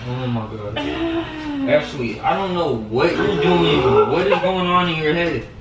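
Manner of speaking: deflated oh my God over the prank; exasperated. Real, not acted.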